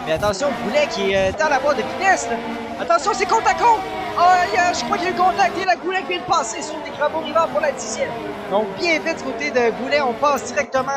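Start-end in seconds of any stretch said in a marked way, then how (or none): 2.8-6.8 motorsport commentator. Real, not acted. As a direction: warn of the clash. Side by side again. (commentateur alarmé côte à côte)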